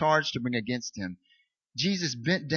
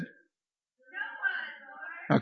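falsetto woman's voice, much higher and softer; a high falsetto, hammed-up woman; 6 dB quieter, much slower than usual. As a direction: squeak it out as the woman. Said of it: "Okay, that works".

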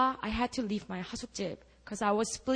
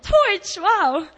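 much louder, high whoop of joy; overjoyed; 12 semitones higher, 12 dB louder, much slower than usual. Real, not acted. Telling the church about free seminary, shouting with joy. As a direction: shout it out in delight.

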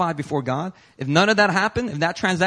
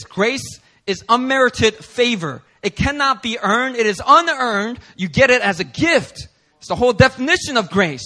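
louder, higher, deliberate declaration; emphatic preaching; 7 semitones higher, 6 dB louder than usual. Real, not acted. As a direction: proclaim each phrase firmly. Preacher defining grace emphatically.

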